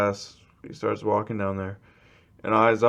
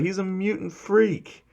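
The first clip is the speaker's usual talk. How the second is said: much higher-pitched, mock class outcry; jeering accusation; 10 semitones higher than usual. Whole class calling their classmate a freak, jeering. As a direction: accuse him in a high voice.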